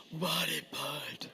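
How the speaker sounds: deep low response; lowest range. Shown as a demonstration. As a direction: drop to the bottom of your voice. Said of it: "In your lowest range".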